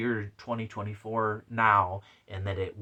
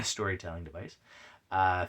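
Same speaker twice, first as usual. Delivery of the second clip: ordinary talk, nothing marked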